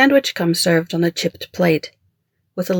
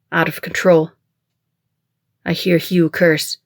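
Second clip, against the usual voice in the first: ordinary delivery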